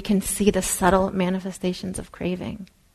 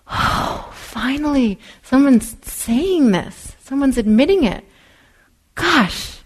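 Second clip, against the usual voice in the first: relieved delight; 5 semitones higher, 4 dB louder, slower than usual. Retold or imitated. Relieved listener hearing a Dharma talk.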